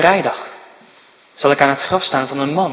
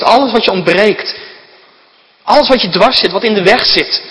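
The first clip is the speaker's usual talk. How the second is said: louder, higher preaching push, building; louder preaching appeal to come to God; fervent preaching; 4 semitones higher, 3 dB louder than usual. Acted. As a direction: plead with the congregation at full force. Preacher calls hearers to God.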